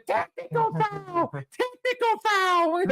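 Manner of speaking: high, slow referee yell; mock referee shout. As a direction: shout the call like a ref. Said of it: "he should have been screaming".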